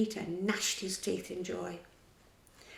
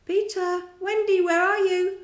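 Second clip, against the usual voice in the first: higher, louder calling across water; 10 semitones higher, 8 dB louder than usual. A narrator's reading. The boys call out for Peter and Wendy, higher and louder. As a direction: shout for lost friends. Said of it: "calling out".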